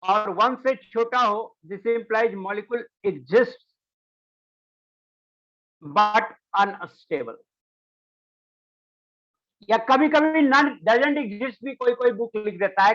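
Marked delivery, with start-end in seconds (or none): 0.0-7.4 drawn-out dictation, word by word; slow dictation. Real, not acted. Teacher dictating when a molecule is unstable, slow dictation. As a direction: spell out the rule slowly for note-takers.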